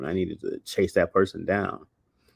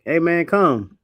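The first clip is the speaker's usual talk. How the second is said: higher, louder call to the dog; 9 semitones higher, 8 dB louder, slower than usual. Pretend. Owner calls a dog that only speaks African, louder call. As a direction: call him over. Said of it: "And you'd be like".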